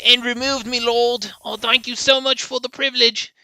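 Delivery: high, hammed-up groveling British voice; sarcastic peasant thanking his master; mock servile courtier.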